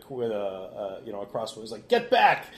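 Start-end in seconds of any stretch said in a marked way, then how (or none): 1.9-2.5 raised, loud command; threatening order. Acted. Servant threatens Froman with a crossbow, threatening order. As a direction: warn someone off at weapon point. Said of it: "threatens him with a".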